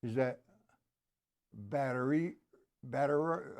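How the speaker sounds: ordinary delivery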